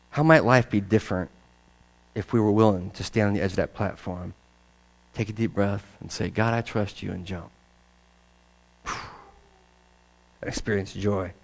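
soft, low, intimate appeal; quiet sermon close. Acted. Preacher closing with a soft, low invitation. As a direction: draw the congregation in gently.

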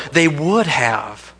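drawn out, deliberate; slow emphatic close. Real, not acted. Preacher insisting critics would have disproved it, slow. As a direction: let it sink in slowly. Preacher drives point home.

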